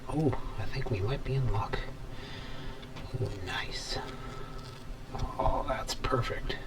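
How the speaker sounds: hushed, thrilled whisper; soft eager whisper at the bench; excited whisper. Real, not acted. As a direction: whisper with real delight as it works. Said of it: "getting this excited over cutting copper".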